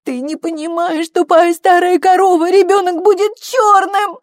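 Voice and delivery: high, loud hysterical yell, slowing at the end; cursing scream. A narrator's reading. Daughter screaming her secret at her mother, cursing scream. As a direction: scream abuse in a frantic high voice. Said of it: "выругалась и заорала на мать".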